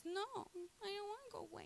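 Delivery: high, soft whimpering plea; sad puppy plea. Pretend. Begging her boyfriend not to send her away, whimpering. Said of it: "the sad puppy eyes".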